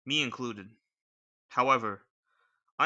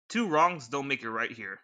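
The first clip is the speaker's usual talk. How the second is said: ordinary talk, nothing marked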